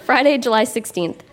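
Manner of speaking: usual speaking voice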